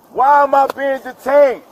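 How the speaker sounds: real outraged protest at being stopped; angry protest. Real, not acted. Protesting being detained by police.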